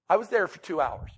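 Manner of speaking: ordinary talk, nothing marked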